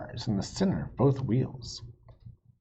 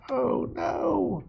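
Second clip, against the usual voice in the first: higher, comic dismayed oh no; 14 semitones higher than usual. A narrator's reading. Narrator groaning at Wild Bill judging. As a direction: squeak it in dismay.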